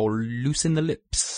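prim precise elocution. Shown as a demonstration. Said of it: "What was that voice thing".

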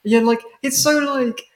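usual speaking voice

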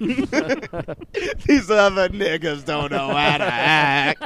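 slow put-on drawl. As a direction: stretch it out in a mock voice. Joking in a put-on voice.

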